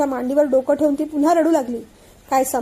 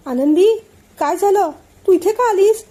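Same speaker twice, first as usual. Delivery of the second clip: alarmed; 6 semitones higher, 5 dB louder than usual. Acted. Husband finds wife in dark.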